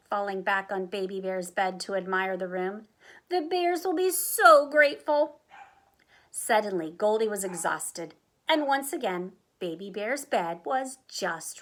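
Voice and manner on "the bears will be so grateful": higher, louder gush; gushing delight. A narrator's reading. Goldie admires her handiwork, gushing delight. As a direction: swell proudly on the praise.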